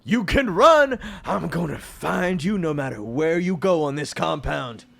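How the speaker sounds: loud, high yell at the fleeing heroes; villain yelling across the compound; threatening villain shout. Acted. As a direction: bellow after them, then settle into menace. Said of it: "you can hear David being like".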